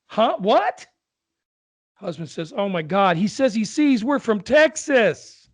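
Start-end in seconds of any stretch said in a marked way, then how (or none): 0.1-0.8 put-on squeaky high, louder old lady's "Huh?"; a confused old lady who can't hear; deaf old lady. Old lady to husband at traffic stop, high confused. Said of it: "With accents and all". 2.6-5.5 put-on high, loud fed-up old man; exasperated old husband. Old husband to deaf wife, loud exasperated. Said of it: "With accents and all".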